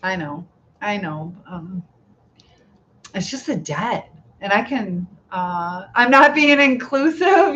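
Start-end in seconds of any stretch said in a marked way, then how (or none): 5.9-7.6 mock admission. Teasing the chat, then laughing.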